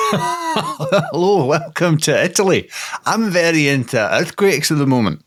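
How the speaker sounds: comic put-on Scottish accent for an Italian. Co-host plays earthquake-loving Italian, in a comic Scottish accent. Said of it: "Scottish Italian".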